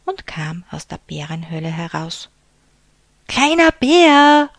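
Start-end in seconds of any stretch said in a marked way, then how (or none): 3.3-4.5 slow, loud, high stretched call; drawn-out call. A narrator's reading. Big bear calling, unable to see little bear, slow loud call. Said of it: "rief der große Bär".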